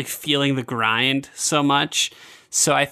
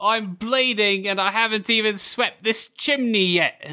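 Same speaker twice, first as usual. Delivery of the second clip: high, slow Cockney lament; high-pitched mock Cockney complaint; 7 semitones higher than usual. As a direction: play a hammed-up Cockney chimney sweep. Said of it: "giving all the stereotypical Cockney accents".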